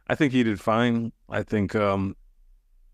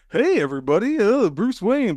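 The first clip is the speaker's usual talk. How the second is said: high, breezy public-persona voice; mock-cheery cartoon playboy, pitched way up; Bruce Wayne impression; 12 semitones higher, 4 dB louder than usual. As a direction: put on a much higher, showy socialite greeting. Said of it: "But then when he's just like Batman".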